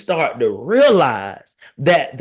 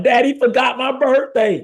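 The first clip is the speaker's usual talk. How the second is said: put-on high-pitched childish complaint; mock crying child; 10 semitones higher than usual. Daughter waking up on dad's birthday, mock tearful. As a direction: lightly imitate a tearful daughter. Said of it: "your daughter woke up crying".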